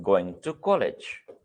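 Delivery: very slow, drawn-out words; teacher reads an example sentence slowly. Shown as a demonstration. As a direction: stretch each word for the class. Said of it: "मैंने आपसे एक sentence कहा".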